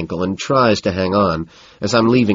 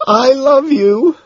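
high, slow, louder wail; miserable wail; 6 dB louder, much slower than usual. A narrator's reading. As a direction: cry it out louder, utterly miserable. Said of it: "he miserably wails".

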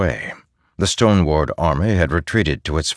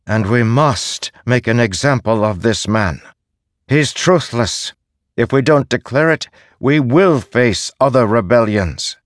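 loud, higher declaration; proclaiming leader; 6 semitones higher, 4 dB louder than usual. Acted. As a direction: announce it to the council. Dulo condemns Szeth.

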